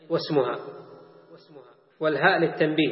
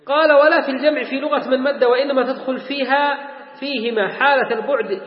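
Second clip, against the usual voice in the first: higher, projected reading voice; reading text aloud; 5 semitones higher, 4 dB louder than usual. Acted. Teacher quoting the author's rule on demonstratives, recitation. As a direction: declaim the book's wording to a class.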